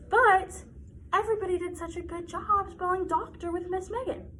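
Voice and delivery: bright, sing-song kindergarten teacher. Acted. Teacher guiding young children through a spelling lesson, sing-song. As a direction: lead little kids through spelling.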